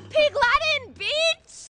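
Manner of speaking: excited taunt. Acted. Shouted taunt at police.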